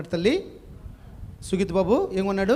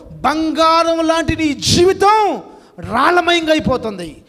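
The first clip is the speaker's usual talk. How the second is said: loud, fervent sermon, climbing higher and louder to the end; preaching at full force; 8 semitones higher, 7 dB louder than usual. Acted. Preacher urging the congregation to change, fervent loud preaching. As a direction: thunder the rebuke, then rise into a prayer.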